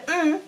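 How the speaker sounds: "Mm" said as a high, short hum that means yes; tonal yes mm. Shown as a demonstration.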